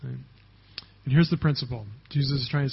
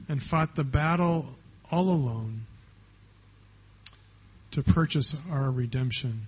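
closing prayer; 2 semitones lower than usual. Acted. Pastor leads closing prayer.

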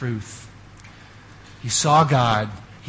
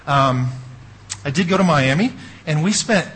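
normal talking voice

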